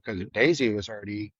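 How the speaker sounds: ordinary talk, nothing marked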